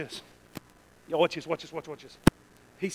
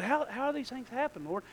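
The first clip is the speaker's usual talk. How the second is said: softer, humble question; gentle, meek asking; 5 semitones higher, 6 dB quieter than usual. Acted. Preacher voicing Mary asking the Lord, gentle question. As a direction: ask it meekly, without challenge. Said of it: "she didn't say it like that".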